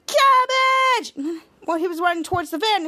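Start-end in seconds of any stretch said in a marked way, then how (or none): 0.0-1.1 battle cry. Retold or imitated. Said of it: "Michael said".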